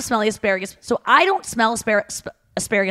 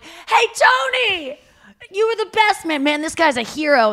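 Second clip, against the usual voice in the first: guys on the corner; 8 semitones higher than usual. Retold or imitated. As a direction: hype him up like a neighbor. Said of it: "all these guys are like".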